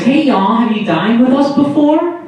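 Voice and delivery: high Southern-waitress lilt, rising question; fake Southern accent. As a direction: put on a fake Southern server voice. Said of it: "I assume it's fake accent".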